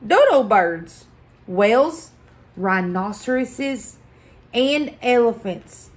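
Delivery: ordinary delivery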